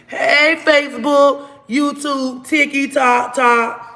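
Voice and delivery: loud, high, bright hello to every platform; excited greeting. Real, not acted. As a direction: call out to your followers like a host. Greeting viewers across platforms.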